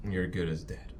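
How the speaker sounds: normal talking voice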